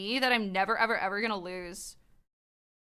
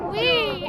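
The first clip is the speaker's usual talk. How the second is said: mock ride whee; 10 semitones higher, 4 dB louder than usual. Riding the drop.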